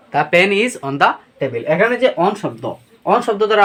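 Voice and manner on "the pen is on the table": "the pen is on the table" read slowly, word by word; teacher giving the example slowly. Shown as a demonstration. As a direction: dictate the sentence clearly. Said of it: "যেমন".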